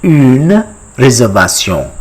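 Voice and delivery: "Une réservation" with rounded u and French r; French pronunciation. Shown as a demonstration. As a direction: teach "Une réservation" ending in nasal -syon. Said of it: "How do you say it in French".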